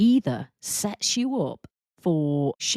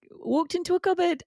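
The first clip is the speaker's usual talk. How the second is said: very high, chirpy admission; sheepish announcement; 13 semitones higher than usual. Retold or imitated. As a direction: own the blunder brightly. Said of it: "walk out of the cupboard and say".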